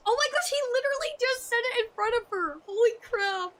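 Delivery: high, loud burst of disbelief; real shock at the reveal; shocked excitement. Real, not acted. Streamer reacts to game scene.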